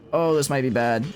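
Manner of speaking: usual speaking voice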